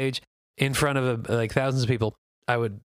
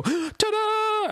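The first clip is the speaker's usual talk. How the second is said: much higher showy ta-da; triumphant ta-da. Pretend. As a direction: announce the reveal like a magician. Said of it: "stand up and go".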